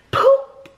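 high, loud popping noise; playful high pop sound; sound effect. Retold or imitated. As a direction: mimic the baby popping out. Imitates baby coming out.

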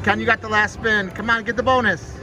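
ordinary delivery

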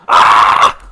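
startled scream. Real, not acted. Chased in game.

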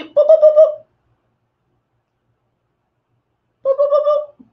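high, bright boop noises; high boop sounds. Real, not acted. Tapping on shadow with high boop sound effects. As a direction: pop out little boops as you dab. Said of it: "sound effects".

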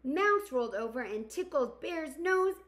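gentle storybook narration; reading to children. Acted. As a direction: read it like storytime for kids. Reads picture book aloud.

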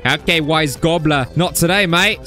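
slipping into a put-on Australian accent; mock Australian. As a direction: play a casual Aussie fending someone off. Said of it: "Sorry, I became Australian there".

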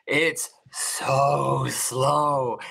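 very slow, stretched-out words; drawn out. Shown as a demonstration. Complaining to co-host about the film's pacing, drawn out. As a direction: drag each word right out.

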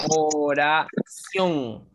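"Oración" very slowly as o-ra-ción; slow dictation of "Oración", o, ra, ción; syllable by syllable. Shown as a demonstration. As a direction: stretch "Oración" into three beats, ción in one. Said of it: "Por ejemplo, en ción".

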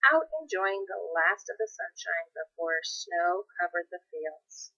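ordinary delivery

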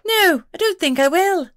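sassy refusal in a tiny voice; defiant pixie. A narrator's reading. Pixie to Scott, refusing him in a high voice.

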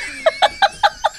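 squealing high laugh; high burst of laughter. Real, not acted. Laughing hard at his friend's story, squealing high.

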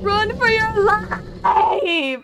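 high, loud, long drawn-out scream; panicked yell. Real, not acted. Player warning co-player of the monster, drawn-out panicked yell. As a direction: scream it, stretching the last word.

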